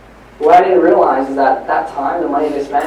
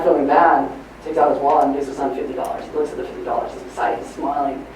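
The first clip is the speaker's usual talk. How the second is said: usual speaking voice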